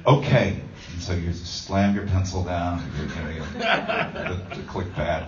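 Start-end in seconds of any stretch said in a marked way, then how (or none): 0.1-0.6 loud, clipped give-in okay; husband giving in with a loud, fed-up okay; grudging okay. Retold or imitated. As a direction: concede it loudly, annoyed. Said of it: "you go like".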